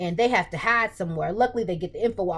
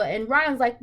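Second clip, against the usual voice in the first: normal talking voice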